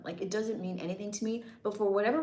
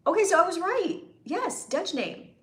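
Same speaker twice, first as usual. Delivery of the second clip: triumphant high exclaim; 9 semitones higher, 5 dB louder than usual. Real, not acted. Guessed the viewer's heritage right.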